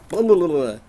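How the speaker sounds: loud, high babbled water-gun burst; cartoon gush of gibberish; imitated attack noise. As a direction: fire off a silly loud babble.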